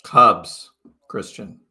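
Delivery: ordinary talk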